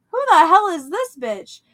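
high, loud indignant question; incredulous mock outburst at an attacker; retold outrage. Dreamer attacked by the creepy lady, loudly indignant.

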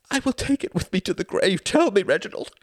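high, loud, desperate vow; pleading mother. A narrator's reading. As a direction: beg urgently at the top of the voice. Mother begs to be told.

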